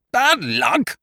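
high, loud, incredulous echo; booming outrage. Acted. Eucridge booming back at the narrator's sympathy. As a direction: boom the words back in disbelief. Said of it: "boomed Eucridge".

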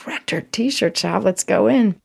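light up at spotting the shop; delighted discovery. Retold or imitated.

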